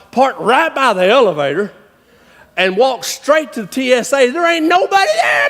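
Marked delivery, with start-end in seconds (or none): 4.3-5.5 high-pitched, comic disbelief; amazed exclamation. Retold or imitated. Traveler finds the airport line empty, amazed exclamation. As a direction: cry it out, voice leaping up.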